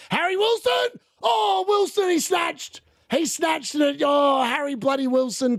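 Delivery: high, shouted frustration at the miss; groaning yell at the striker; excited shout. Real, not acted. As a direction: cry out his name in disbelief. Near goal chance, shouting.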